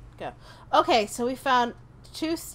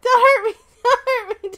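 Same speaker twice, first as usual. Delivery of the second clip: laughing plea; 12 dB louder, faster than usual. Real, not acted. Friend angry about lost items.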